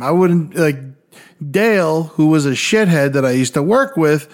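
normal talking voice

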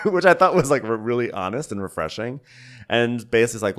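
ordinary delivery

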